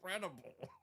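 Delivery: breathless laughter. Real, not acted. Host winded by her co-host's crude joke, breathless laughing. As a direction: wheeze it out between laughs.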